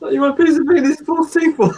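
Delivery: high-pitched giggly quote; laughing while quoting the scene. Retold or imitated. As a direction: squeak through laughter.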